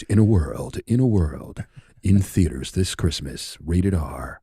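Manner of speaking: deep, dramatic trailer announcer, lightly done; movie trailer guy, slow and grand. Shown as a demonstration. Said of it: "you get the deep voice".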